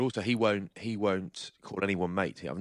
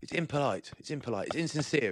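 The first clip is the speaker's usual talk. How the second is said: mock posh friend; 7 semitones higher than usual. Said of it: "is what he says".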